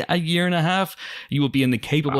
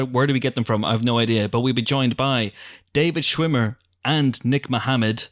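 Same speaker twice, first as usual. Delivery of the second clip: ordinary delivery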